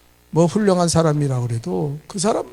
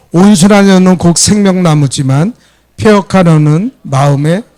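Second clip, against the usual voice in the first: loud, measured group recitation; strong read-along voice; congregational scripture reading; 10 dB louder than usual. Real, not acted. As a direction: lead everyone in reading the verse aloud. Leading congregation in reading aloud.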